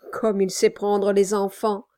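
usual speaking voice

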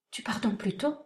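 panicked, breathy whisper; frightened whisper. A narrator's reading. Chiffon, alarmed at his packed trunks, whispers. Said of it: "Affolée, elle demanda".

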